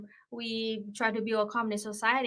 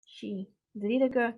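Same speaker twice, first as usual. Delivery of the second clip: normal talking voice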